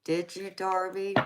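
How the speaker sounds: ordinary talk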